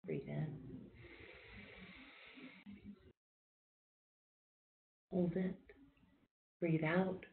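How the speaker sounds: soft, calm breathing cues with long pauses; quiet meditation guide counting breaths; guided breathing. Acted. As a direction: ease the group through each breath, gently. Leads a guided breathing exercise.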